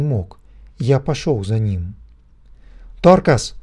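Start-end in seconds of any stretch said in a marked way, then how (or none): 3.0-3.5 a loud, higher, desperate cry of the name; desperate shout. A narrator's reading. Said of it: "отчаянно крикнул он". (отчаянный крик)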